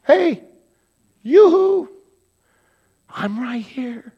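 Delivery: high, loud sing-song calling; calling out to get attention. Pretend. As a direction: wave someone down from across the room. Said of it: "God's gonna be standing right in front of you going".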